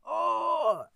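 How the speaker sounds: high, loud exasperated oh; mock fuming groan; seething oh. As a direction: blurt it out like a fuming man. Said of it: "he was like".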